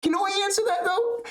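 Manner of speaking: very high mocking voice of the interrupter; high nasal-free mock whine; mocking impression. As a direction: squeak it out like a whiny pest. Mocking Ian Gary's interruption.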